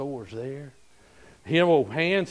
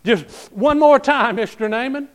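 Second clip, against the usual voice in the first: excited plea; 7 semitones higher, 5 dB louder than usual. Acted. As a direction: urge him on one last time. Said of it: "they're getting excited".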